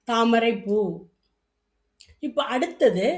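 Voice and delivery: ordinary talk, nothing marked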